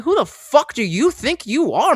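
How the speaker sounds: indignant retort, high and slow; retold screaming match. Dog walker confronting a screaming stranger, indignant. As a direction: challenge him, leaning on each word. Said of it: "we got into a screaming match".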